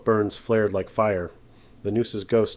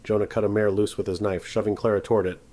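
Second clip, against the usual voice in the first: ordinary delivery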